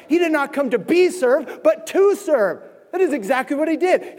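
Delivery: high, loud sermon delivery; fired-up preaching. Acted. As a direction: preach it out with full conviction. Preaching at full force.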